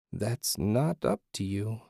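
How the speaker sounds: soft, slow otherworldly woman; lightly voiced eerie female apparition. A narrator's reading. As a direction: refuse quietly and slowly. Disembodied female voice refuses.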